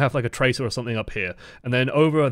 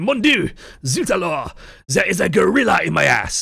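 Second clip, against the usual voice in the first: loud, high mock-French outcry; French accent; 6 semitones higher, 4 dB louder than usual. Streamer voicing a player ambushed by Chris, exaggerated French accent. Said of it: "That French accent?".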